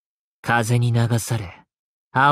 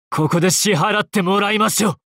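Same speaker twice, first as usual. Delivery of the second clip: raised, high-pitched demand; battle declaration; 8 semitones higher than usual. Acted. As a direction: pass sentence on the foe, pitched high. Finishing attack in a game. (高い声で敵に宣告)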